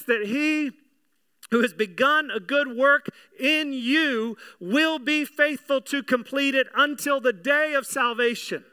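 usual speaking voice

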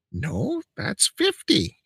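high, drawn-out mock cashier; dismissive refusal. Cashier refusing customer the bigger discount, dismissive.

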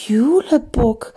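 slow, whispery shocked gasp of the name; drawn-out breathy dismay; horrified breath. Acted. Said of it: "hauchte Mathilda entsetzt".

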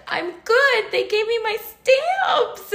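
very high, gleeful near-squeal; elated relief. Retold or imitated. Telling partner about the stamps, high elated squeal. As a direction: squeal it through a laugh, drawing out the end. Retold joyful answer to partner.

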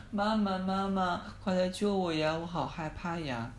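high, slow pleading cry; frightened call for mom. Acted. Lost boy calling his mom in the forest, frightened and pleading. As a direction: plead for mom to come and save him. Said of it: "他就说真的很害怕".

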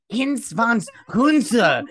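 loud, high gibberish German parody; mock German. Mocking how German sounds with fake harsh German. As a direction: bark fake German, hammed up. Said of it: "This sounds like Klingon".

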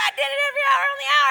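very high, loud, racing reply; hammed-up shrieking excitement; excited shriek. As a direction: squeal it back at full tilt. Excited answer in retold talk.

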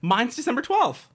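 very high, loud, overexcited exclamation; mock amazement. Reacting to someone's birthday, loud high mock amazement. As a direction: fake being thrilled by a huge coincidence.

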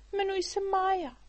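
put-on a small girl calls down from above; little girl's voice. A narrator's reading. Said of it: "odpověděla holčička". (vysoký dívčí hlásek)

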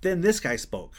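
ordinary talk

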